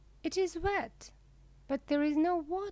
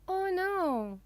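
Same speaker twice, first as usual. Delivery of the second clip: crying out oh no; 7 semitones higher than usual. Acted. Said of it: "we cry together with Blackie".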